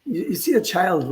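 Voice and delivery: usual speaking voice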